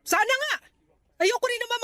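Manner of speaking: high, curt snap of doubt; sharp retort. Acted. Mother doubts her daughter's promise, sharp retort. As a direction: throw it back at her.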